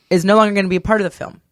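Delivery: ordinary talk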